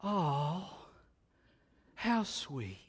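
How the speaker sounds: soft, drawn-out "oh" of mock delight; gently gushing, mock-sweet; cooing admiration. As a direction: coo softly at something adorable. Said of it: "you'd be like".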